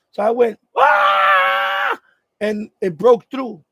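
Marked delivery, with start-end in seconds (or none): 0.7-2.0 long, loud, high scream from the gut; retold scream. Man with blocked airway refusing to die, long loud scream. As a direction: scream like your life depends on it. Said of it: "I'm gonna scream".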